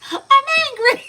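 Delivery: very high, loud mock fury; hammed-up angry kid yell; imitated child's temper. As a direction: shriek it in a pretend tantrum. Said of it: "one hell of a temper".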